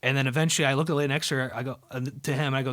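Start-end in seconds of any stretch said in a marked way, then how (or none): none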